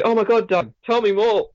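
high-pitched put-on excitement; hammed-up eager fan; fake hype. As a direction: gush with exaggerated interest on demand. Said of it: "You're supposed to be hyped up".

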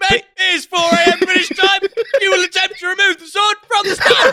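a hard man bossing the king, high and cocky; hammed-up high geezer voice barking orders. Geezer King Arthur commands sword pull.

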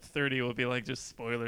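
normal talking voice

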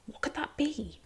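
breathy wondering whisper; hushed musing. Real, not acted. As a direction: whisper it to yourself, curious. Wondering aloud about castella cake.

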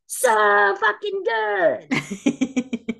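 drawn-out high mock scream; all-caps scream. Quoting an all-caps review, slow high mock scream. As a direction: stretch it slowly and loudly like all caps. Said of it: "starts out screaming in all caps".

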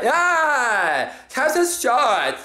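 put-on high, drunk party-girl squeal; hammed-up party girl, the last words drawn out slowly. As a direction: yell it like a tipsy girl at a bar. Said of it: "one of those party bitches".

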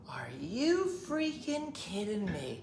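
put-on hushed, slow grumbling old lady; old lady's complaint. Old lady in the car cursing, hushed and slow. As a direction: mutter it like a cranky old lady.

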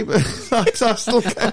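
slow, high words broken by laughter; laughing. Real, not acted. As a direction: laugh through it. Laughing mid-sentence.

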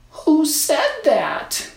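put-on high, slow startled girl; startled little girl. A narrator's reading. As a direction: ask like a scared child. Girl hears hidden voice.

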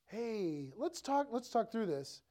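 soft, gentle suggestion; loving, polite. Acted. Spouse raising a problem kindly, loving and soft. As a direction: ease in quietly, coaxing kindly. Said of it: "maybe in a loving way, polite way".

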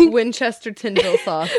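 quick, stumbling start through a laugh; giggling mangled name. Shown as a demonstration. Friend mangles the sauce's name, giggling and quick. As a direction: rattle off the silly wrong name while laughing.

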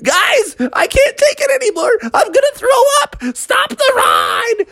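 high moans rising to frantic cries; panicky queasy mother. Acted. Mother begging off the ride, high panicky wailing. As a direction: wail in falsetto, about to throw up. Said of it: "And she's like".

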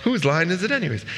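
high, bright show-title announcement; game show announcer. Shown as a demonstration. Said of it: "get the joke?".

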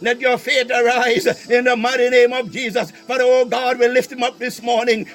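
normal talking voice

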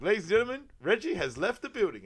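loud, high stage announcement; imitated show announcer, projecting; mock announcer. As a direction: proclaim it to the whole club.

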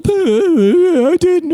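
imitating a crying little boy; mock bawling kid. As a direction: whine it like a sobbing seven-year-old. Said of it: "And I was just bawling".

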